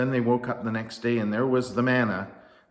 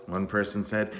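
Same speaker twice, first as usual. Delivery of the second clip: plain reading voice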